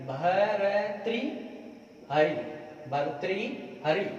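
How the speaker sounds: भर्तृहरि slowly, with a clear "tri" vowel; slow correct form. Shown as a demonstration. Teacher to students, slowly modelling the right name. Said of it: "ये शब्द है भर्तृहरि".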